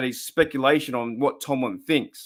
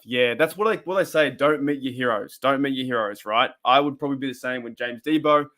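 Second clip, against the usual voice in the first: ordinary delivery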